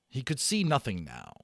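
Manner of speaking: usual speaking voice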